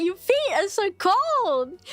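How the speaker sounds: slow, high complaining dad voice; imitating dad. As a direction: whine it like a startled dad. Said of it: "He's like".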